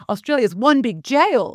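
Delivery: mild imitation of an Italian accent. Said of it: "she has this great Italian accent".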